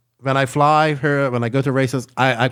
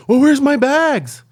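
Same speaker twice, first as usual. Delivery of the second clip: mock clueless friend; 12 semitones higher, 4 dB louder, slower than usual. Friend's clueless question, mimicked.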